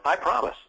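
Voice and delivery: "promise" hit high, lifting above the rest; stress on promise. Shown as a demonstration. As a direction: pitch up and lean on "promise". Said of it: "hit the word promise".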